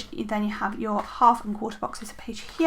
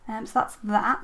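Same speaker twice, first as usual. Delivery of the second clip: normal talking voice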